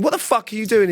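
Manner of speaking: retold angry shout. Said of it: "I said to him".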